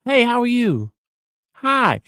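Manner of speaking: bright, high friendly greeting with a soft smile. Shown as a demonstration. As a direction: greet them warmly, smiling, pitched high. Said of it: "This is a soft smile".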